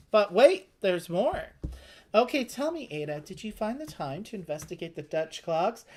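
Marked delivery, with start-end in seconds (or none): 0.1-1.4 hammed-up "but wait, there's more"; mock TV salesman reveal; infomercial pitch. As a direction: sell it like a late-night ad host. Infomercial catchphrase played up.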